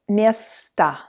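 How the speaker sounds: "место" said slowly as MYES-ta, ending in a relaxed A; unstressed O as A. Shown as a demonstration. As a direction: stretch "место", final O reduced to "a". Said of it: "like to say it as A".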